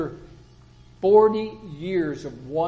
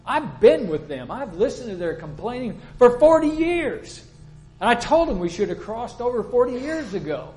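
higher, louder, incredulous reluctant leader; Joshua's protest; 5 semitones higher, 5 dB louder than usual. Retold or imitated. Joshua objecting to God about leading the people, incredulous and higher. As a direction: plead against being put in charge, in disbelief. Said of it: "Joshua's thinking".

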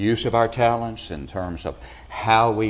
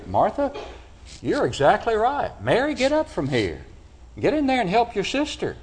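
much higher, eager voice of approval; imagined hearty agreement; 11 semitones higher than usual. Retold or imitated. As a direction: boom out the verdict Martha hoped for. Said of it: "felt that the Lord was going to say".

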